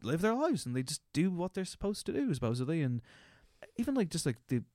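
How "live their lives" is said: stoner voice. Pretend. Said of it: "I know I sound like a stoner at a party".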